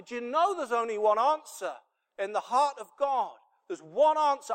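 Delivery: high, loud cry from the pulpit; impassioned preaching. Real, not acted. Preacher telling how the father runs to the cry, impassioned shout.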